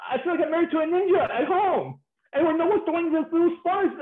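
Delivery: high-pitched mock complaint; hammed-up husband's protest, pitched high; exasperated husband. Husband complaining about his covered-up wife, exasperated.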